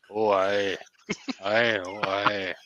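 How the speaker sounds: put-on Scottish accent. Teasing Wayne's half-Scottish speech, mock Scottish accent. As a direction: imitate a Scottish accent, low and quiet. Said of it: "the Scottish accent where he".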